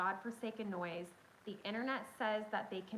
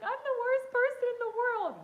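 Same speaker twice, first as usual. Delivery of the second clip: high, loud, wailing self-blame; 9 dB louder than usual. Retold or imitated. Mom wailing to her son over the hurt turkey, hammed-up sob. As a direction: wail it like a melodramatic sob.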